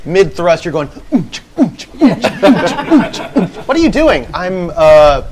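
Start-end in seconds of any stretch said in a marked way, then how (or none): 0.9-3.7 high, rhythmic mock grunts keeping the beat; rhythmic oonch noises. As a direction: chant oonch in time like a clapper. Mimicking the clapping sound.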